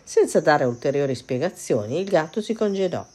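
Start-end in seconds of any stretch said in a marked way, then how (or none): none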